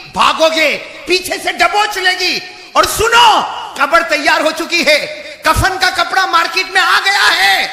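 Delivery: loud, high-pitched sermon shout; full-force preaching. Acted. Ali warns Kufa's people of death, full-force preaching. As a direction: thunder a warning at the congregation.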